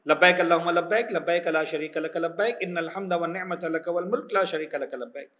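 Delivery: slow, measured Arabic recitation; talbiyah recitation. Shown as a demonstration. Teacher showing pilgrims the four lines of talbiyah, slow recitation. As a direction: recite evenly, drawing out each labbaik.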